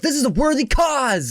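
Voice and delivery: proudly convinced; mock noble. Baby wipe cleaning a keyboard, proud. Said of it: "I'm thinking to myself".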